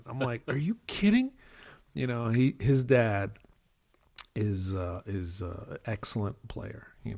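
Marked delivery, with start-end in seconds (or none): 0.5-1.3 incredulous question. Retold or imitated. Incredulous reply.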